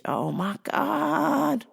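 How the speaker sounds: fed-up mock outburst; exasperated. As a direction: beg the caller to shut the baby up.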